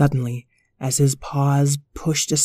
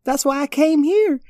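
puppy voices; 6 semitones higher than usual. Acted. Puppies chat about flying.

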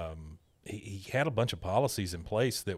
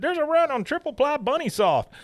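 high squeaky hoarder's voice; panicky shopper; 3 dB louder than usual. Retold or imitated. Shopper with a cartful of toilet paper, high squeaky panic. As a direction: pitch up into a frantic little squeak. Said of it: "he said".

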